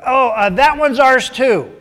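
loud, high, drawn-out mock protest; acted quarrelsome claim. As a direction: shout a greedy claim, hammed-up. Herders quarrel over another well.

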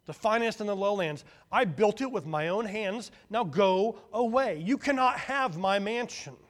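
loud, high, angry insistence; angry boasting. A narrator's reading. Hut brother defending his mansion, loud and angry. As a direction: shout down the intruder.

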